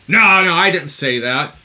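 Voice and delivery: loud, imitated protest; mock defensive outburst; loud denial. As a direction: deny it loudly, mimicking the caller. Acted denial in retold phone row.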